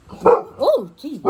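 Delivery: sudden high yelp of fright; startled gasp. Real, not acted. Reader startled by the dog mid-story, yelps. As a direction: jumps at a noise.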